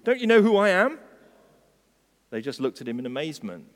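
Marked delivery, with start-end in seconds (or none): 0.0-1.0 high, loud, cross command. A narrator's reading. Offended colt ordering the market crowd, crossly. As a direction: order them about crossly. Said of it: "he said crossly".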